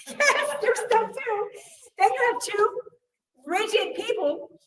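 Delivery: high, laughing delivery. Real, not acted. Teacher to class, laughing at the joke. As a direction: laugh through the punchline. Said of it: "laughing at the punchline".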